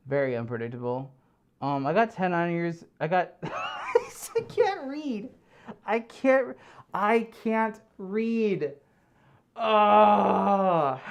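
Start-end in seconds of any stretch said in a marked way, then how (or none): 3.4-8.7 laughing. Real, not acted. 9.6-11.0 exasperated groan. Real, not acted.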